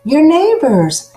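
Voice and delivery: loud, high call through a door. Acted. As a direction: call out to the house. Villagers calling at the door.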